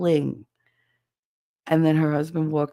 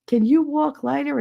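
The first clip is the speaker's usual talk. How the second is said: higher-pitched mimicked nagging; scolding grandmother; 8 semitones higher, 4 dB louder than usual. Grandma to child stomping indoors, scolding. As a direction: nag like grandma about noisy footsteps.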